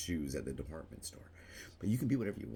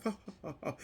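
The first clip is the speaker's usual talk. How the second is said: mock laugh; faster than usual. Mocking laughter.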